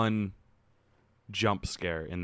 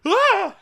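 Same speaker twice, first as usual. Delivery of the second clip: sudden high, very loud yelp; mock audience scream; 14 dB louder than usual. Imitating everyone in the theater, startled scream. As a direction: shriek as if jumping in fright. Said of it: "everyone in the theater except me is like".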